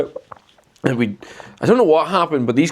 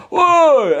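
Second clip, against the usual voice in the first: high, loud drawn-out holler; retold shout; 6 dB louder than usual. Retelling the parked man yelling at them, loud holler. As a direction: holler it out in mock outrage. Acts out the parked man's yell.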